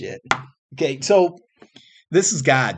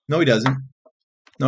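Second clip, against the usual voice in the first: ordinary talk, nothing marked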